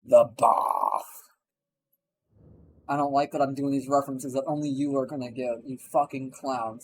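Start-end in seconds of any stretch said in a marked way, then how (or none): 0.0-1.0 low put-on voice, a light Murdoch send-up; a quick lowered mock Murdoch line; Murdoch impression. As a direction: growl it out lower like Murdoch would. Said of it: "not sounding like fucking Murdoch".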